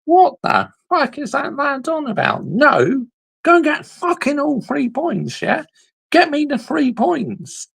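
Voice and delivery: high, indignant rant; exasperated disbelief; outraged. Real, not acted. Outraged reaction to the fan.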